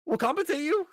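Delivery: a high, wheedling offer of pay; squeaky pleading voice. Retold or imitated. Manager coaxing the boys into boot camp, high wheedling voice.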